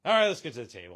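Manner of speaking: loud call to move on; brisk announcement. Real, not acted. Host moving the show to the table, announcing loudly. Calling the move to next segment.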